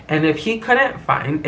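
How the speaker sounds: usual speaking voice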